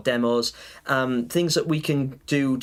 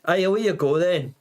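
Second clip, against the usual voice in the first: put-on friendly-aggressive Scottish accent, pitched up; put-on Scottish accent, a little higher; 4 semitones higher than usual. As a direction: call it out like a cheeky Scot, in a Scottish accent. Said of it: "I love the Scottish accent".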